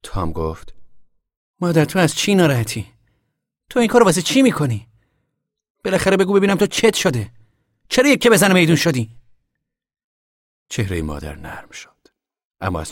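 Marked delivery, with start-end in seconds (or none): 1.6-9.2 much higher, louder pleading demand; upset son. Acted. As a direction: demand an answer, alarmed. Tom confronts upset Ma.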